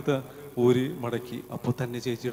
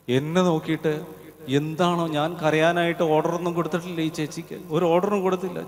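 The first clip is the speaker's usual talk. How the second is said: ordinary delivery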